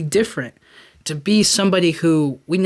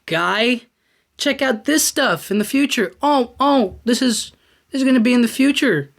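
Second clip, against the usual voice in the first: higher, eager ghost showing the future; lively ghost voice; 6 semitones higher than usual. Retold or imitated. Ghost showing Scrooge the future, mock excited guide voice. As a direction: point it all out like an excited guide. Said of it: "And he shows him".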